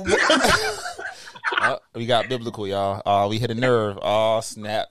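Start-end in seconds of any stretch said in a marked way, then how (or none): 0.2-1.1 loud high-pitched laugh; high burst of laughter. Real, not acted. Mocking a friend's biblical talk, loud high laugh. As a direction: cackle loudly, way up high.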